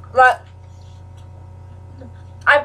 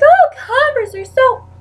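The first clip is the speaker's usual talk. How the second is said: mock gushing; 7 semitones higher than usual. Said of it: "everybody's like".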